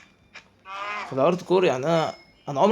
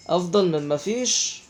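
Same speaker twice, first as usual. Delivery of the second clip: slow, rising at the end on مفيش; resigned slow repeat; slow drawn-out; 2 semitones higher, slower than usual. Real, not acted. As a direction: stretch out أفضل من مفيش slowly, lifting the end. Repeats phrase slowly, rising.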